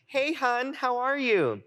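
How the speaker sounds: high, sing-out phone hello; lightly hammed-up high friendly voice; imitating friend's greeting. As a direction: greet a dear friend affectionately. Friend's cheerful phone greeting, acted.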